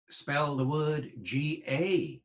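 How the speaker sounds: usual speaking voice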